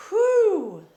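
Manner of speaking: loud, high exhaled "whew"; big relieving whew. Real, not acted. Card reader steadying herself after nearly crying, big whew. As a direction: blow out the emotion in one loud whew. Overcome reader releasing the emotion.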